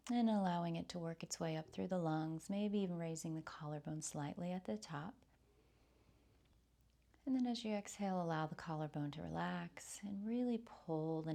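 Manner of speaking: soft, soothing breath-led meditation voice; guided meditation. Real, not acted. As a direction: softly guide them through the breath, with long pauses.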